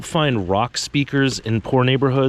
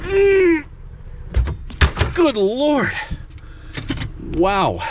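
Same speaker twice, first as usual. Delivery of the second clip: high strained grunt under weight; straining to lift, high pitched; carrying heavy load; much slower than usual. Real, not acted. Hoisting battery by hand.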